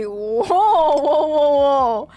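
loud, awed exclamations; amazed whoa. Real, not acted. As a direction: gasp out in amazement. Amazed at a pull.

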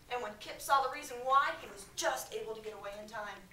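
ordinary talk

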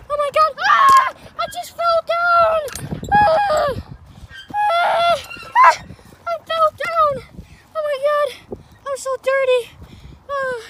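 high, loud cries after a pretend tumble; hammed-up screaming complaint; dramatic play yelling. Pretend fall, yelling about it.

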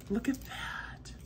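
soft awed whisper. Real, not acted. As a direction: breathe it out in a whisper. Hushed at gift.

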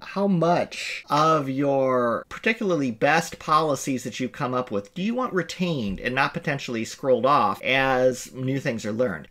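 ordinary talk, nothing marked

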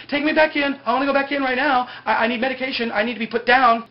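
high, loud mock-desperate plea; frantic pleading. Retelling a man begging to be taken back in, mock frantic.

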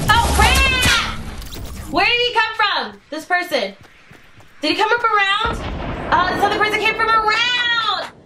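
real, high-voiced dismay; startled. Real, not acted. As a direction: cry out as if caught off guard. Startled by attacker, loses game.

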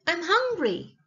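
complain, expecting to be served; complaining princess. A narrator's reading.

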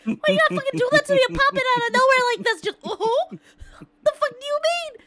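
loud, high and fast after a real scare; startled yelling. Real, not acted. Streamer yelling at a friend who startled her, rattled and loud. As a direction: shout it out, rattled and half laughing. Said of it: "scared the shit out of me".

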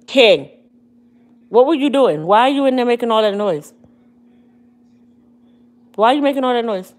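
loud exasperated scolding; scolding the dog. Real, not acted. Owner scolding her dog for howling, loud exasperated shout. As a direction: shout at him for the noise.

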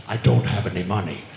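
low, fast threatening growl; Liam Neeson impression. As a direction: menace him low and quick. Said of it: "Jason Statham".

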